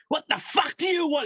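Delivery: hammed-up gruff welcome; imitating a gruff snap. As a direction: play the hard man answering the door. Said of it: "He opened the door".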